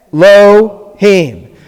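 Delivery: Elohim slowly in syllables, eh-LO-HEEM, opening on a short eh; correct pronunciation. Shown as a demonstration. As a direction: say Elohim drawn out, joining the L to the second syllable: eh, lo, heem. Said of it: "It's not pronounced Elohim".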